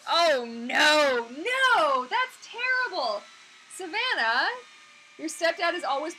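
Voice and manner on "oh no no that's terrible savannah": high, loud cries of sympathy; outraged wailing no, drawn out slowly at the end; loud dismay. Real, not acted. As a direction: gasp out your shock at the news. Reacting to a sad chat message.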